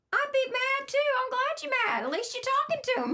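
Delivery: very high, animated, emphatic voice; imitated nun. Nun validating her anger at God, imitated high and emphatic. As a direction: imitate a feisty nun egging someone on. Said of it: "And Sister told me".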